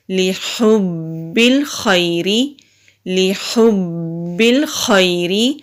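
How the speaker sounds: slow, melodic recitation with a rising end. Shown as a demonstration. Teacher recites the phrase twice for students, slow recitation. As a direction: intone it slowly, each syllable drawn out. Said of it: "لام کے نیچے زیر ہے".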